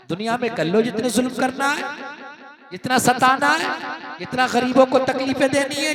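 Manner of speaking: loud ringing sermon delivery, sustained pitch; full-force preaching. Acted. Preacher warns oppressors of the day of reckoning, full-force preaching. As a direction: preach it out to the whole congregation.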